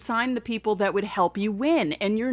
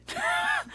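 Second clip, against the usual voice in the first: high burst of laughter; 3 dB quieter than usual. Real, not acted. Laughing at own outrage.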